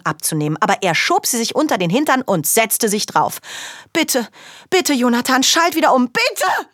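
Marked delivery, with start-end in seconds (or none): 3.9-6.7 put-on high pleading child voice; begging. Girl begging Jonathan to switch back, much higher. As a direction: beg him over and over.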